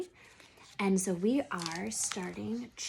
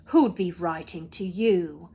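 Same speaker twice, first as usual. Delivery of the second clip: sneering; 2 semitones lower, 7 dB louder than usual. Acted. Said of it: "sneered Uncle Vernon".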